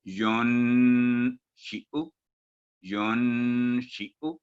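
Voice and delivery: युन शिउ drawn out with a hidden nasal n; slow recitation. Shown as a demonstration. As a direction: recite युन slowly with ikhfa. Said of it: "नून पर इख्फा होगा".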